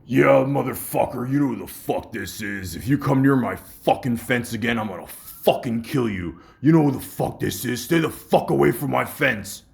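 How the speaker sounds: loud, menacing imitated caller; agitated threat. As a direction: snarl the threat at the fence intruder. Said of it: "he seemed a little agitated".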